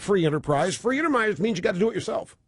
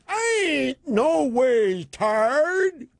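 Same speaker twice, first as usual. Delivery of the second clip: slow, drawn-out put-on church accent, higher; Hillary's mock Southern drawl; mock Southern preacher drawl; 5 semitones higher, much slower than usual. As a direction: stretch it out like a Sunday sermon.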